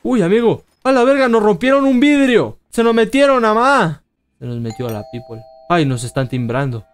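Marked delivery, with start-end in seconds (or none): 0.0-4.0 high, loud panicked shout. Real, not acted. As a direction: cry out as glass breaks. Break-in panic.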